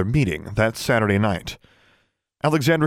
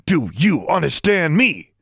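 furious demand; 7 semitones higher, 3 dB louder, slower than usual. A narrator's reading. Shaking her furiously.

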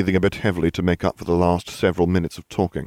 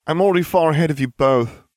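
declaring; 10 semitones higher, 4 dB louder than usual. A narrator's reading. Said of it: "I declared".

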